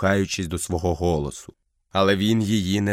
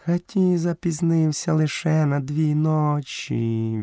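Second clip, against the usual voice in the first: high, slow panther voice; unhurried, high-pitched excuse; panther character; 7 semitones higher, slower than usual. A narrator's reading. Panther explains Hathi was only two nights late, high voice. Bagheera defends herself in character.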